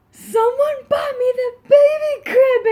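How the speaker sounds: high, loud, tearful squeal; overjoyed and weepy, voice cracking up high; near tears. Retold or imitated. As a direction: choke it out through happy tears. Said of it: "I almost started crying".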